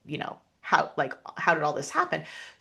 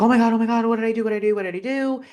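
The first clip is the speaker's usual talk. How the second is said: panicked; 6 semitones higher, much faster than usual. Retold or imitated. Attacker panicking, retold.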